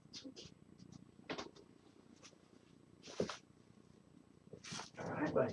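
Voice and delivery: whispering a cat's name over and over; whispered calling. Real, not acted. Owner coaxing hiding cat Topaz, whispering her name.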